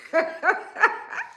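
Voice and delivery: short rising laugh; high laugh. Real, not acted. Vlogger laughing at the crowd's gullibility. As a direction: laugh in disbelief.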